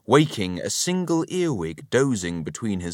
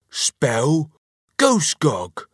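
loud, higher-pitched challenge; raised, insistent voice; demanding; 7 semitones higher, 5 dB louder, much slower than usual. A narrator's reading. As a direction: demand it like a bossy husband. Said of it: "he demanded".

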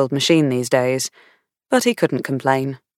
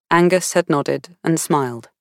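plain reading voice

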